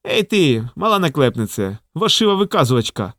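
ordinary delivery

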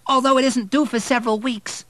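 high, loud, worried voice; alarmed maid. A narrator's reading. Maid alarmed at early labour.

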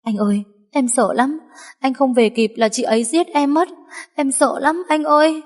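high whimpering plea to her lover; cling and whine as if terrified; fake crying. A narrator's reading. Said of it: "ôm chồm lấy Quân khóc lóc". (giọng khóc lóc giả vờ)

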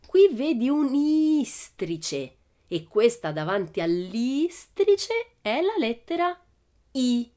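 slow, high, drawn-out teaching voice; slow explaining. Acted. Teacher showing Pippi a letter, slow and drawn-out. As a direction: spell it out for a child.

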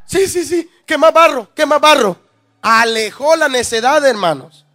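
fuerte y lento, martillando cada frase; predicación a toda voz. Acted. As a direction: predica el versículo con fuego. Predica el versículo con fuerza. (predicación encendida a la congregación)

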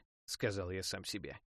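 ordinary delivery, nothing marked

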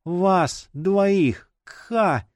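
high, choked dying words; forced out, dying. A narrator's reading. Bleeding wife's last words to family, high and choked. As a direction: squeeze it out, ending in a choke. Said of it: "выдавил из себя Джина".

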